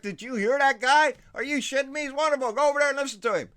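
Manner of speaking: loud, high, gushing mock fan; imitating an excited fan. Fan back from a Beto rally raving to a friend, loud and gushing. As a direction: gush like a starstruck rally-goer.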